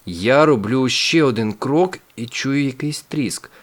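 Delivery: ordinary delivery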